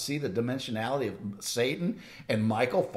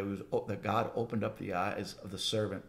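ordinary delivery

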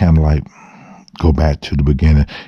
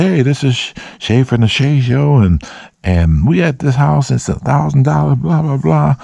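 video host intro; 8 semitones higher than usual. Retold or imitated. Retelling her first video intro.

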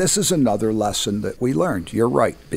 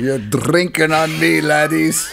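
hammed-up Scottish host, louder and higher; mock Scottish brogue; 6 semitones higher, 5 dB louder than usual. Scottish owner offering the band free drinks, mock Scottish brogue. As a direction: roll it out like a Scottish publican. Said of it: "Guy had a Scottish accent".

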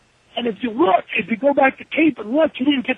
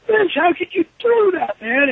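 upset; 7 semitones higher than usual. Retold or imitated. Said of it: "I'm like".

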